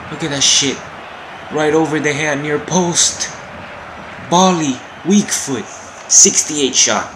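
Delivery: loud, high, hyped recap; real excited yell; excited shouting. Real, not acted. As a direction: shout the praise for the goal. Replaying a great goal.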